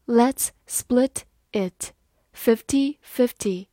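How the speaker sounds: each word spaced out slowly; slow, careful demo read. Shown as a demonstration. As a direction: read it slowly for learners to follow. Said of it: "好，慢慢来读。".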